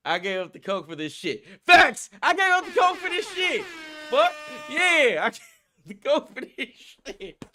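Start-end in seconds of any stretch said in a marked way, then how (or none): 1.7-7.5 high, loud triumphant yelling; hype yell. Real, not acted. Celebrating quitting coke to the crew, loud yell. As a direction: shout it like a battle cry.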